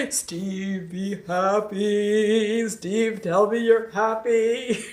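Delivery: very slow, hammed-up villain croon through laughter; evil tone. Host answering a viewer's request, in a mock evil tone. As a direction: stretch "be happy" like a cartoon baddie. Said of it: "Sing in an evil tone".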